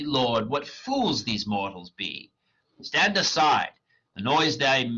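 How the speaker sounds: dramatic reading of verse; reading Shakespeare aloud. Acted. Reader voicing Hermia rejecting Demetrius, dramatic. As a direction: read the lines as the character, measured. Puck and Oberon lines read.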